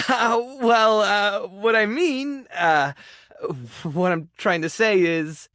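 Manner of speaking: awkward fumbling with a little laugh; flustered. Acted. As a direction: nervously search for the words. Flustered confession, losing the thread.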